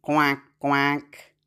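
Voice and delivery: low duck quacks, evenly spaced. Shown as a demonstration. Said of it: "Now, duck".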